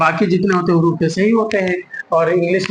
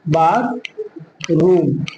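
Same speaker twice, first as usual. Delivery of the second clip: Bathroom split in two with a long gap; slow syllable-by-syllable; 2 semitones lower, much slower than usual. Shown as a demonstration. Teacher breaks the word up for learners, very slowly. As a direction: say बाथ, pause, then रूम.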